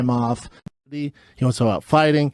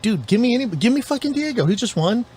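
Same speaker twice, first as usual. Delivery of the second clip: mildly hammed-up, higher-pitched plea; retold pleading demand; 9 semitones higher than usual. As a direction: imitate someone urgently asking for a fight. Acting out a pleading fighter's demand.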